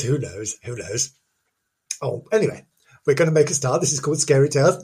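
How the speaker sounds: ordinary talk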